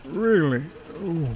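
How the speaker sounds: high, stretched yawn through the words; sleepy yawn. A narrator's reading. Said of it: "yawned Gordon sleepily".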